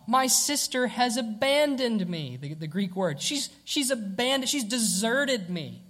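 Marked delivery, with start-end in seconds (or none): 0.1-2.4 slow, higher and louder, drawn-out complaint; milk the abandonment, slowly; dramatic complaint. Retold or imitated. Preacher mimics Martha's dramatic complaint to Jesus. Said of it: "You ever spoken like that?". 3.2-5.8 dramatic complaint. Retold or imitated. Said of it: "You ever spoken like that?".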